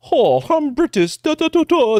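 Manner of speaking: very high, drawn-out mock British voice; mock British accent. Mocking the Pilgrims before accents changed, high British caricature. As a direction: caricature a Brit in a high lilt. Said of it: "they all had British accents".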